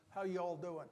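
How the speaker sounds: normal talking voice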